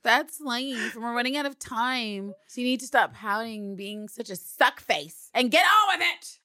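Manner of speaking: high, hammed-up angry speech; mocking recital. Eve scolds Villanelle in a send-up, loud and exasperated. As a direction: deliver bad dialogue as exaggerated scolding.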